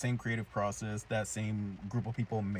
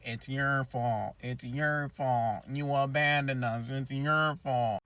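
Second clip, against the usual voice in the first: slow, drawn-out chorus of blame, pitched higher; hammed-up old folks wailing an accusation; accusing old people; 4 semitones higher, 4 dB louder, much slower than usual. Old townspeople blaming the girls, slow drawn-out mock wail. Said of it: "they're all old people coming out saying".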